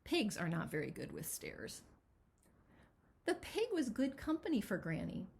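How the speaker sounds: ordinary delivery, nothing marked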